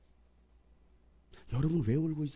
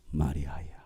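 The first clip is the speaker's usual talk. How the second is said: low, tender calling of a name; loving call; 11 semitones lower, 3 dB quieter, slower than usual. Acted. Jesus calling weeping Mary by name, low and loving. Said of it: "사랑스럽게 그의 이름을 부르십니다".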